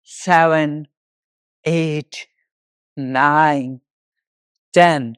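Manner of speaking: drawn-out single counts with pauses between; exercise count-off. Real, not acted. Yoga teacher counting walking steps for the class, instructor's count-off.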